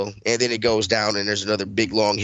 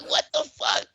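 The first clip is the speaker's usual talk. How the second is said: high squeaky disbelieving what the fuck; stunned squeak of disbelief. Retold or imitated. Son riding the shaking truck, squeaking in disbelief.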